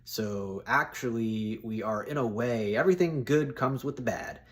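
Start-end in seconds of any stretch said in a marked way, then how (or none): none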